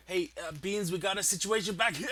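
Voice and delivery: usual speaking voice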